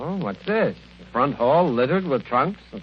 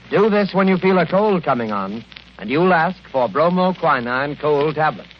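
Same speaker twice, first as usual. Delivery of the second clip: bright, pushy sponsor pitch; nineteen-forties radio commercial voice; radio ad read; 3 semitones higher, 4 dB louder than usual. Acted. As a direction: sell the cold tablets like an old-time announcer.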